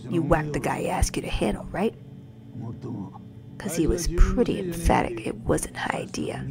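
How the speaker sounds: whispering the game dialogue; whispered read. Acted. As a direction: read the lines in a hush. Reading game dialogue hushed.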